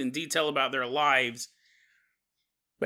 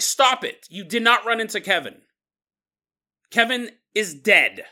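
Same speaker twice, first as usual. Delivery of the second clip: acted outrage, louder and higher; mad; 3 semitones higher, 4 dB louder than usual. Tammy insisting to Miles that Kevin is dead, angrily. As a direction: play her hammering each point home, fed up. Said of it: "Tammy's getting so mad".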